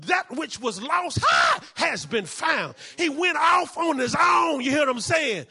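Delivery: loud, slow, emphatic proclamation; triumphant shouted sermon delivery; full-force preaching. Real, not acted. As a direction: draw the words out and drive them home.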